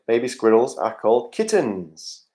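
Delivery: normal talking voice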